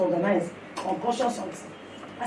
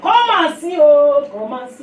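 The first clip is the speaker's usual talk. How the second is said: high, raised call starting strong; retold calling out; 3 semitones higher, 7 dB louder than usual. Wife calling her husband to come and see, retold call. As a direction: call someone over to look, voice lifted.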